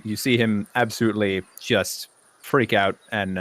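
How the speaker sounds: normal talking voice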